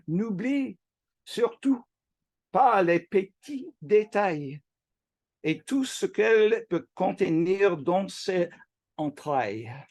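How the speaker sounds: quoting a poem aloud in French; French reading. Shown as a demonstration. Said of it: "please forgive my mediocre French accent".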